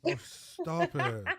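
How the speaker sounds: high, slow, exaggerated protest; a hammed-up squeal of fake offence; playful mock outrage at the teasing; mock coy protest. Teased about his accent by co-host.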